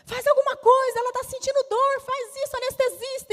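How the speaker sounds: high, loud urgent shouting. Retold or imitated. Doctors shout at the anesthetist, high and urgent. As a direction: yell orders across the room. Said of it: "foi uma gritaria entre médico".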